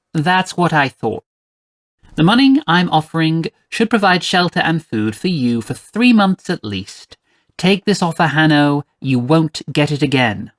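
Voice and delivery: haughty mother closing the deal; stern, imperious employer. Acted. Mother making Hanno a final offer, stern and imperious. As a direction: press the offer with firm, pointed emphasis.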